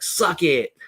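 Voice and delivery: lightly imitating a thick Spanish accent. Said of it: "in a thick accent's like".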